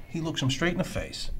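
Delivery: ordinary delivery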